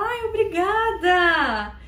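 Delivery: delighted, grateful thank you; gushing thanks. Shown as a demonstration. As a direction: thank them with a big smile. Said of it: "você fala".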